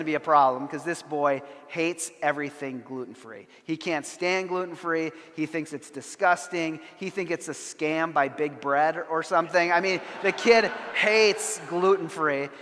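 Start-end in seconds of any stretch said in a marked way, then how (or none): none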